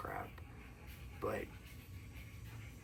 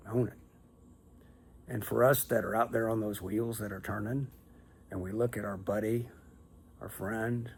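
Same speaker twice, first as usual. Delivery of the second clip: ordinary delivery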